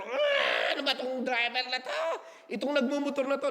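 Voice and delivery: high-pitched exasperated driver's outburst; mock road rage. Preacher acting out cursing-free road complaint, mock exasperated.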